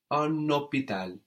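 "un hôpital" linked with a sounded N; obligatory liaison. Shown as a demonstration. Teacher explains the required link, "un hôpital" joined by N. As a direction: carry the N of "un" into "hôpital". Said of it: "We have an obligatory liaison there".

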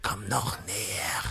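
whisper. Acted. The dying prince whispers to the terrorist to come closer. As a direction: draw them in with a hushed whisper.

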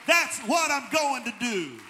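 loud, impassioned preacher building momentum; full-force preaching. Real, not acted.

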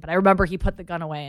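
usual speaking voice

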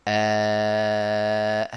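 long, steady held "aaah"; sustained alif sound. Shown as a demonstration. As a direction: stretch out an open alif vowel on one level note. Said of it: "هذا الامتداد للصوت هذا هو حرف الألف".

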